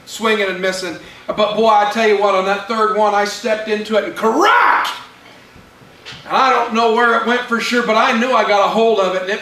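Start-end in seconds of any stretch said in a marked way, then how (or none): 4.2-4.9 sudden loud, high burst; explosive bat crack. Retold or imitated. Batter connects on the third pitch, explosive. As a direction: punch out the word like a hit. Imitates bat hitting the ball hard.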